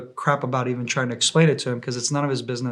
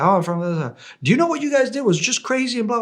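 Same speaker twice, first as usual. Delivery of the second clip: much higher, worked-up rant; agitated American soldier; 10 semitones higher, faster than usual. Retold or imitated. To the drone about the near miss, higher agitated rant. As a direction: mimic a panicky guy going on and on.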